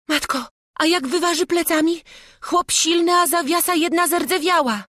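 put-on very high, loud child's voice; alarmed, high-pitched worry; frightened boy. A narrator's reading. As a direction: fret in a scared lad's squeal. Boy fears robber breaking out.

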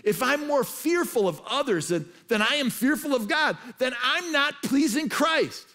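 high, loud preacher's declaration; impassioned preaching. Real, not acted. Preacher warning the congregation about pleasing others over God, impassioned. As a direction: drive each point home, loud and high.

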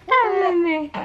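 high coaxing call; coaxing baby talk. Acted.

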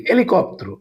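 হেলিকপ্টার said without the h, as "elikoptar"; silent h. Shown as a demonstration. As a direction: drop the h sound in হেলিকপ্টার. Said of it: "আগার উচ্চারণ নাই". (হ ছাড়া হেলিকপ্টার)

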